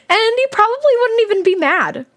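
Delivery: high-pitched, tickled storytelling; amused high narration. Acted. Reader setting up the polite reply, high and amused.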